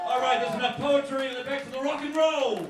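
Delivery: loud, high call to the crowd; rallying stage shout. Real, not acted. Poet sends crowd back to the music, rousing shout. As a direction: holler it out to the whole room.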